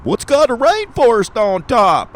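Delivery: very high, loud mock Baltimore accent; hammed-up Baltimore accent, squeaky and loud; put-on Baltimore accent. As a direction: belt it like an over-the-top Baltimore local. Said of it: "All right, that's enough of that".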